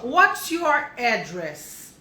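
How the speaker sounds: very slow, drawn-out model question. Shown as a demonstration. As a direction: stretch every word for learners. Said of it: "esse aqui é interessante".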